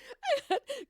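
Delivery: real laugh; high burst of laughter. Real, not acted. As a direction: giggle fit, high. Laughing at her own blunder.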